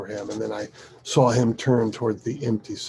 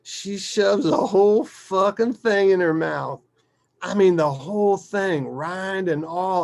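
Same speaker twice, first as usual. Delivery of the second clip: higher, louder, marvelling at it; incredulous father storyteller; 8 semitones higher, 6 dB louder than usual. Acted. Father telling how she ate the whole lemon, incredulous. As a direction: relive the disbelief for a listener.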